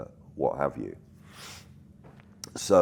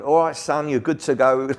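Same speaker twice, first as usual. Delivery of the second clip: put-on higher, louder, cheeky old crew geezer; hammed-up matey old boy waving him off; old bloke; 10 semitones higher, 7 dB louder than usual. Smoke operator signs off to Tom, cheeky and matey.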